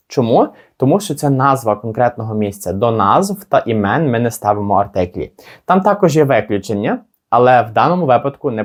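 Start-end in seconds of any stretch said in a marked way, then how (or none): none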